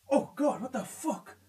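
very soft, low, startled mutter; hushed dismay. Real, not acted. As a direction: let it slip out under your breath. Startled by creepy game moment.

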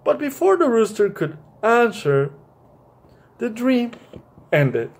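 gentle storybook reading voice; reading to children. A narrator's reading. Teacher reading a picture book to young listeners, gentle storytelling.

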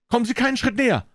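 very high, urgent woman's cry; high-pitched, worked-up threat; agitated warning. A narrator's reading. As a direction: ward off an attacker, pitch right up. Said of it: "rief sie erregt".

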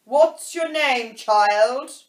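loud, slow, commanding question; imperious demand. A narrator's reading. Queen asks Alice her name, imperious and loud. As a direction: boom it out like a bossy queen.

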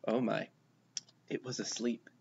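quiet, hushed wonder. A narrator's reading. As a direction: hush so as not to wake it. Finding sleeping creature.

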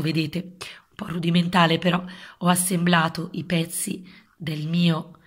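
hushed show-and-tell; whispering. Acted. Whispered aside to kids.

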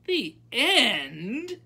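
high, loud, indignant question; outraged high-pitched echo. A narrator's reading. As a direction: challenge it loudly in disbelief. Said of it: "said Abilene indignantly".

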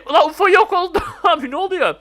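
high, loud surprise with laughter; amused astonishment. Real, not acted. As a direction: react astonished, then laugh. UFO suddenly vanishes.

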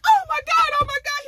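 very high mock shriek; high-pitched frantic oh my God; shrieking mother. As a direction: squeal it like a panicked mom.

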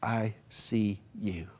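gentle whispered reassurance; quiet and gentle. Shown as a demonstration. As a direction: say it very gently, like comforting someone. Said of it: "And you say quietly".

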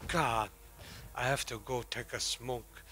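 ordinary talk, nothing marked

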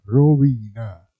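ordinary talk, nothing marked

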